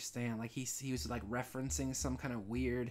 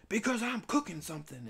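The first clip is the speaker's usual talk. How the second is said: angry clerk; 7 semitones higher, 6 dB louder than usual. Retold or imitated. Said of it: "angry Cumberland Farms guy was like".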